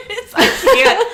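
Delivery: can barely talk for laughing; laughing hard. Real, not acted. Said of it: "I'm laughing way too hard".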